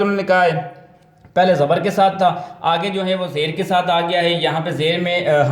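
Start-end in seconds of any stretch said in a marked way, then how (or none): none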